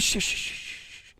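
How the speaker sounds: soft, repeated voiceless shushes; imitated shushing. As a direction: imitate a man hushing her, trailing away. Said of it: "the guy says that to her".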